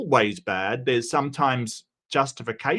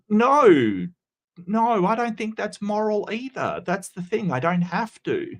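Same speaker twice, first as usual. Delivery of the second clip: wrestling commentator; 7 semitones higher than usual. Acted. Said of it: "I'm trying to sound like a wrestling announcer".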